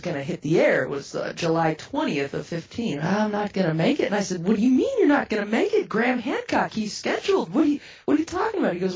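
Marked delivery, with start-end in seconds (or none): 4.5-8.7 high, rushed disbelief; reenacted baffled outburst; incredulous protest. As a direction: demand an explanation in alarm. Protests his pulling out.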